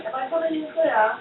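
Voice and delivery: high imitated British kid accent, clipped TV voice. Boy appearing on the TV in Willy Wonka, high British accent. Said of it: "very thick British accent".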